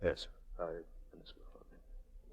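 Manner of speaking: barely breathed, voiceless; a whispered reply to the prisoner; stunned whisper. Acted. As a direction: whisper it in shock.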